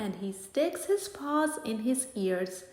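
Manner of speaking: ordinary delivery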